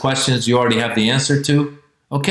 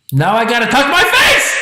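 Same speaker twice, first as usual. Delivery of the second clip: high strained comic yell; exasperated outburst; 10 semitones higher, 4 dB louder than usual. Acted. Itchy viewer cursing the TV doctor, exasperated yell. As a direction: explode in mock torment, pitch shooting up.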